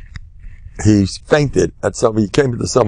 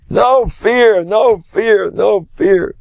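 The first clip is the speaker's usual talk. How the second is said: starts much higher, then sinks back down; lightly mimicked rabbi, fading; fading exhortation; 9 semitones higher, much slower than usual. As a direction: repeat it, letting the fervor ebb.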